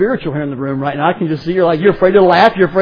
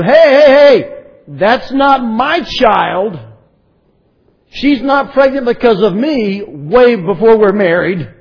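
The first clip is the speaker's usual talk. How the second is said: loud, slow, defensive "hey hey hey"; indignant protest; 4 semitones higher, 4 dB louder, slower than usual. Retold or imitated. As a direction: insist it wasn't you, drawn out. Joseph protesting to everyone.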